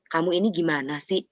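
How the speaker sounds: restrained, even-pitched question; low intonation. Shown as a demonstration. Parent questioning a child, low-key intonation. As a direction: ask it without raising the voice. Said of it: "Nah, itu beda tuh".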